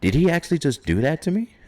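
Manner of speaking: high, fast disbelieving voice; stunned disbelief. Retold or imitated. Stunned Roman asking if Rock really just eliminated him, high and disbelieving. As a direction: squeak it out, as if he can't believe it.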